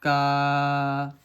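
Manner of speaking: का said loud and full, long aa at the end; full syllable ka. Shown as a demonstration. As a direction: sound out का clearly with its aa. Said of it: "का बोलते हैं".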